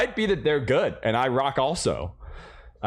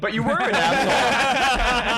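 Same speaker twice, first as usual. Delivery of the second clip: laughing; 10 semitones higher, 5 dB louder, slower than usual. Real, not acted.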